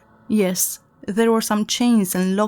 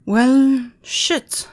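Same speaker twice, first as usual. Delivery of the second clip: dismayed; 5 semitones higher, 5 dB louder than usual. Acted. Realizes he will die.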